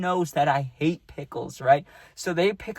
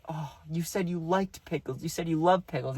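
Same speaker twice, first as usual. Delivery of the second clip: softer, let-down voice; disappointed; 3 semitones higher, 4 dB quieter than usual. Retold or imitated. Girls catching him in the pickle lie, disappointed. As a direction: sound mildly hurt at being lied to. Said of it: "the disappointment on their faces".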